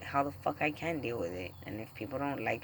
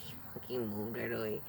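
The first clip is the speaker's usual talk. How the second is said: normal talking voice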